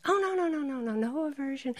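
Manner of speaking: high, laughing denial; amused protest. Retold or imitated. Teacher brushing off the student's worry, amused and high. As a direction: wave it off with high-pitched laughter. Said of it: "really started laughing".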